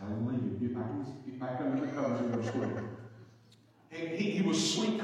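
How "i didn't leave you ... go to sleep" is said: quiet, low, fast reassurance; soothing dad. Retold or imitated. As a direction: settle a sleepy child back to bed. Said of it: "I said".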